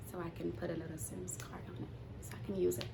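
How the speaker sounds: fast hushed whisper. Retold or imitated. Whispering the plan to her friend in the car. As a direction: breathe it out quickly without voice.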